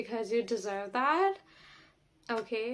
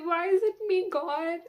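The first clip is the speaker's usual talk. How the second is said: mock despair; 7 semitones higher, 7 dB louder than usual. Dramatic lament to God.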